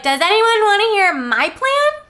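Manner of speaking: keen heroine piping up; mock eager squeak. Bella to the vampires, mock eager. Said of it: "Bella's like".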